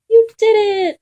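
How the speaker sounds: high, loud happy cheer; excited cheer. Acted. Spotted dog cheering his friend's success, excited high.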